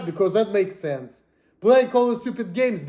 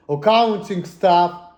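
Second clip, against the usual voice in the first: normal talking voice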